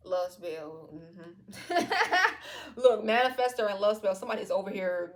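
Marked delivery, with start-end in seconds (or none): 1.9-2.7 real high-pitched burst of laughter; loud high laugh. Real, not acted. Reader amused by the love spell card, loud high laugh.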